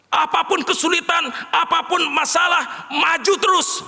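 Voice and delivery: rallying shout. Acted. Preacher urging the congregation on, a high rallying shout. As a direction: drive them forward with each word.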